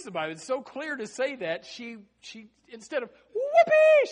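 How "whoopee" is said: very high, loud whoop; acted glee. Girl hearing Peter at the gate, gleeful high whoop. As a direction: whoop it out in hammed-up joy. Imagined joyful reaction.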